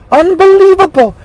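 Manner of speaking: a shocked, high Unbelievable; outraged, high exclamation. Real, not acted. Vlogger outraged by Pelosi, high disbelief.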